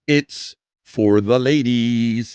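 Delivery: slow, seductive tease; slow sultry drawl. Pretend. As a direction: draw it out slowly and smooth. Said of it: "slow teasing delivery".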